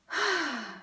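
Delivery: long winded exhale; breathless sigh. Real, not acted. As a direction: let out a tired sigh. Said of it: "Just gonna have a breather".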